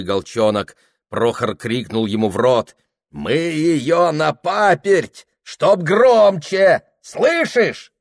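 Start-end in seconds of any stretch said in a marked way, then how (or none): 3.1-7.9 loud, slow, high shouted words; shouting. Acted. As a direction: shout it right into his ear. Shouting into the old man's mouth.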